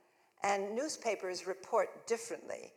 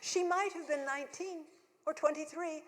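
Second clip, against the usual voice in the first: lightly mocking reviewer voice, pitched up; mock reviewer, higher; 9 semitones higher than usual. Actress mocking the reviewer's gush, pitched up. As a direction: mimic the reviewer's gushing tone. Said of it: "quoting the reviewer".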